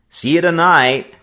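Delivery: friends call out high and loud; calling back. A narrator's reading. Friends calling back to Joe, loud and high. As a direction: shout it back down the street. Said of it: "they called back".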